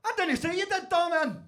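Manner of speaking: high-pitched acted comeback; mock quarrelling reply; heated retort. Acted quarrel in retold scene.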